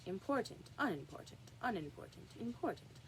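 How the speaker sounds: softly muttered to himself, trying each word; quiet undertone, weighing the words. A narrator's reading. King testing words to himself in an undertone. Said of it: "went on to himself in an undertone".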